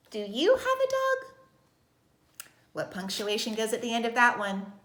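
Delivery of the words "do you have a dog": slow, very high question held up through "dog"; exaggerated asking sentence, "dog" left high; questioning rise. Shown as a demonstration. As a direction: ask it expressively, pitch soaring to "dog". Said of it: "I'm asking you a question".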